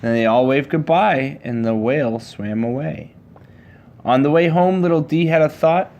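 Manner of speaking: usual speaking voice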